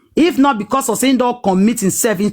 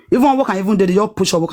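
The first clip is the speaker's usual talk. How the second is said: ordinary talk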